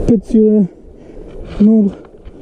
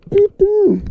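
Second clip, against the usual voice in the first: high, awed swear; excited; 12 semitones higher than usual. Real, not acted. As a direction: squeal of disbelief. Overwhelmed by the catch.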